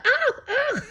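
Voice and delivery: very high shocked yelp; startled cry. Retold or imitated. Deja grabbed by the hair in the jacuzzi, shrieking very high. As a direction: yelp like your hair got yanked.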